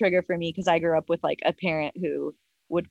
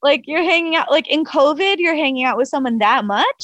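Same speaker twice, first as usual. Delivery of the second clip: higher, louder retold disbelief; incredulous questioning; 7 semitones higher, 5 dB louder than usual. Girlfriend questioning boyfriend about hanging out during covid, incredulous. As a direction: press him as if it's absurd.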